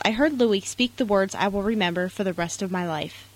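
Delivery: ordinary delivery, nothing marked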